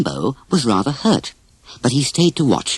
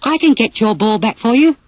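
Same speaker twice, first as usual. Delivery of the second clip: higher, lightly voiced young elephant; Dumbo; 9 semitones higher than usual. Dumbo offers to fetch the boys' ball, higher and light. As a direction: offer help in a bright little voice. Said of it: "suddenly said".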